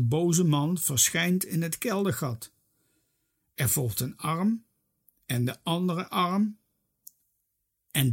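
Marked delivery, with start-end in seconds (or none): none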